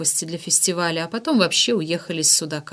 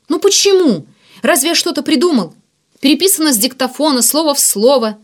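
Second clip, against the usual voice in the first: high, loud pleading argument; heated protest; 7 semitones higher, 6 dB louder than usual. A narrator's reading. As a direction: start high on indignation, then insist. Ignat pleads his case in argument. (горячо и обиженно возражает)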